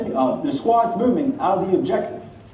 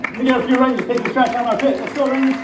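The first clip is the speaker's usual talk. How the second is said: shouting orders; 8 semitones higher than usual. Acted. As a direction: yell at the Rangers to hurry. Instructor yelling for Rangers.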